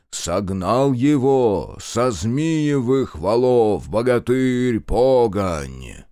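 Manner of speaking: slow, drawn-out ritual chant; trained orator voice. A narrator's reading. Minkult leads the ritual, stately intoning. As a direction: intone it slowly like a ceremony leader. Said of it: "заговорил поставленным голосом".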